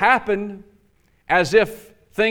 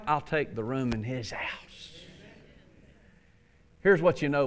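usual speaking voice

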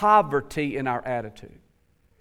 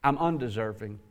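humble hush; 8 semitones lower, 8 dB quieter than usual. Retold or imitated. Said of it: "The other says".

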